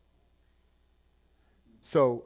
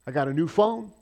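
loud, higher, fast sales hype; hammed-up tech announcer; mock product pitch; 5 semitones higher, 7 dB louder, faster than usual. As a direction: hype the new phone like a keynote. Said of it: "and he says".

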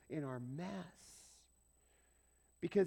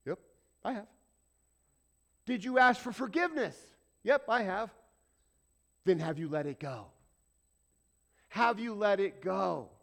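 raised, rising preacher's questions; preaching; 4 semitones higher, 8 dB louder than usual. Acted. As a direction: press the congregation, building. Preaching at full force.

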